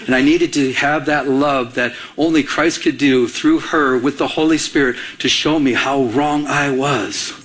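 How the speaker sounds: usual speaking voice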